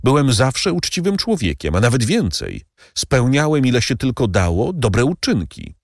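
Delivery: slowing on the last words; anxious servant Bertuccio. A narrator's reading. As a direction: insist on your honesty to the count. Bertuccio voiced throughout.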